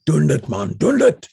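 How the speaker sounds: South African friends urging, rising sharply; urgent Afrikaans plea. Retold or imitated. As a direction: beg him in Afrikaans-tinged English to just do it. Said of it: "they say".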